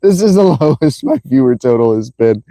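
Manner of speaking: helpless laughter breaking up the words; laughing. Real, not acted. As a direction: keep cracking up, restart the sentence through giggles. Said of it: "That's why I'm laughing".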